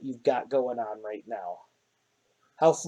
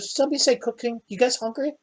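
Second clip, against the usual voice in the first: put-on high child voice, perking up at food; little girl voice pitched up, eager and chirpy; eager little girl Sunny; 10 semitones higher, faster than usual. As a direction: pop in as an eager kid hearing about dinner. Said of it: "She goes".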